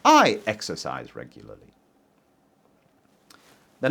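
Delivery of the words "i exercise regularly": "I" stressed, the rest of "I exercise regularly" lower and lighter. Shown as a demonstration. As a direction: hit the word "I" to contrast with other people. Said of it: "not Tim".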